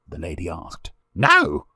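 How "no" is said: put-on sharp, louder, higher boy's no; fierce little outburst; almost fierce. A narrator's reading. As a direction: deny it hotly, like a child. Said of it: "almost fiercely".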